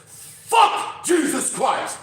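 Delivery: full-force, high-pitched drawn-out shout; sudden booming outburst, imitated in earnest. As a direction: bellow each word slowly across a theater. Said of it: "this man shouts".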